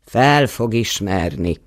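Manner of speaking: plain reading voice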